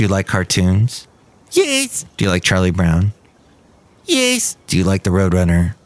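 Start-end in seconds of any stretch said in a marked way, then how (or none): 1.5-2.0 high cartoon voice answering; squeaky cartoon yes. Cartoon guest answering the host, high squeaky. 4.1-4.6 high cartoon voice answering; squeaky cartoon yes. Cartoon guest answering the host, high squeaky.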